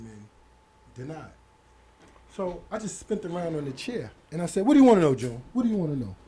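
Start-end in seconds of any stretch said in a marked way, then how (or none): 4.6-6.1 loud, sharp what do you wanna know; retold annoyed outburst; irritated reply. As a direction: snap back at a pestering friend. Snapping back at cellmate.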